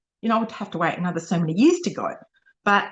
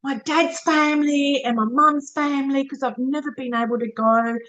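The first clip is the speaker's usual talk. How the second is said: high, thrilled gasp of joy; oh my gosh excitement, voice jumping high; excited inner thought; 6 semitones higher than usual. Retold or imitated. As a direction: relive the moment the news hit, pitch way up. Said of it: "I thought".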